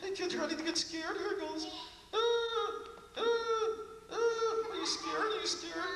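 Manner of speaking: very high, silly monster voice; a goofy monster trying to be scary; Mister Monster voice. Acted. Monster trying to frighten the kids, very high silly voice.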